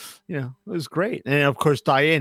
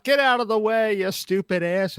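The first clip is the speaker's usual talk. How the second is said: gruff sailor; 7 semitones higher than usual. Retold or imitated. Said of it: "Ben Garner is the guy".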